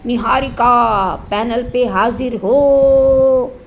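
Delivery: hammed-up courtroom summons; mock court summons. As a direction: summon her like a court clerk, slowly. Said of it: "एक आवाज़ में निहारिका जो है उनके पास खींची चली आएगी".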